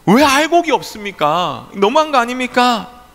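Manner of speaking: high, loud complaining to heaven; indignant protest. Acted. Farmers protesting to God over empty husks, high indignant voice. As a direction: take God to task, aggrieved. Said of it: "하나님께 따졌습니다".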